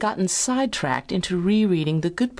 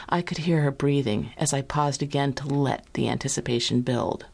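usual speaking voice

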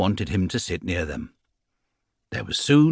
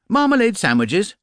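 higher, bright matter-of-fact answer; cheerful explanation; 13 semitones higher, 5 dB louder than usual. Acted. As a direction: explain it happily, no idea of trouble. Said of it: "explained Paddington cheerfully".

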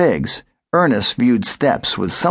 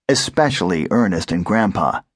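ordinary delivery

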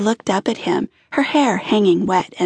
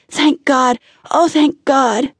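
relieved sob; 5 semitones higher, slower than usual. A narrator's reading. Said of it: "she sobbed".

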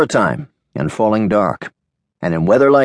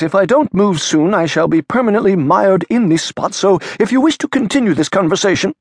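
lightly raised, fussy priest voice; a high, fussy clergyman, hurrying near the end; old priest; 11 semitones higher than usual. Acted. Priest speaking in the rain.

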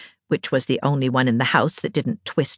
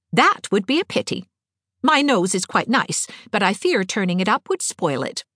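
higher, lightly mock-serious girl's voice; Anne, playful; 6 semitones higher than usual. A narrator's reading. Anne joking about her nose, playfully mock-serious. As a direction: joke along primly, pitched up.

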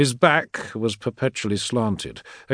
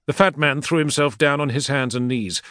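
plain reading voice

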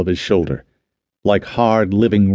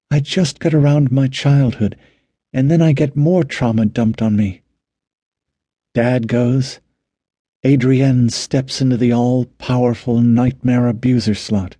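higher female character voice, venting; weary, troubled Ellen; 4 semitones higher than usual. Acted. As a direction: lift the pitch for a worn-out woman complaining.

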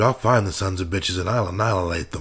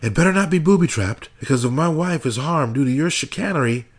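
whisper yelling; 10 semitones higher than usual. A narrator's reading. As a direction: rage barely held in, spat out. Husband yells at FBI, whispering.

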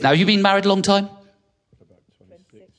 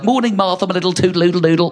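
camp sing-song dad greeting; 4 semitones higher than usual. Retold or imitated. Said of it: "I'll say things like".

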